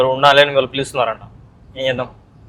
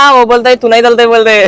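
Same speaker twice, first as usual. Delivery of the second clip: higher, louder, hammed-up Hindi whine about money; mock Hindi speaker; 7 semitones higher, 6 dB louder than usual. Retelling to a friend how brother dodges paying, mock Hindi rant. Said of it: "ఒక ఆరు పోయి".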